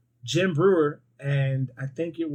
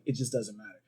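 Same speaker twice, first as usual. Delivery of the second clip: low, soft indifferent murmur; deadpan shrug; 5 semitones lower, 9 dB quieter than usual. Retold or imitated. Farmer answering neighbor, low and quietly indifferent. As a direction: say it softly like nothing matters. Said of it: "the guy's just like".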